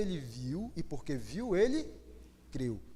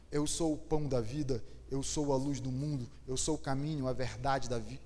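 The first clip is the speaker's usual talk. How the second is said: softer, tender voice of an angel nudging Maria; gentle angel voice; 6 semitones lower, 4 dB quieter than usual. Acted. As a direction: speak softly, like an angel leading her to reflect. Anjo provoca Maria gentilmente. (voz suave e terna de anjo)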